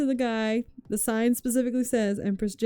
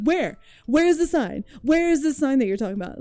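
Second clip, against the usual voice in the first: higher, louder, fast bartender shout; mock angry yell; 6 semitones higher, 5 dB louder than usual. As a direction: bark the questions like a mad bartender. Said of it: "yelling at us".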